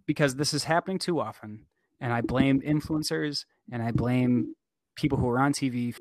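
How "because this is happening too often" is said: usual speaking voice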